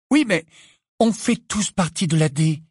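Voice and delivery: usual speaking voice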